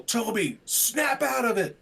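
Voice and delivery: high, drawn-out yell at Toby. Acted. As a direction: yell to snap someone out of a trance. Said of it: "And I yell".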